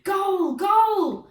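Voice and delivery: high, loud roar of "goal"; crowd roar. A narrator's reading. Crowd cheers Hotfoot Henry's goal, roaring. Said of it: "roared the crowd".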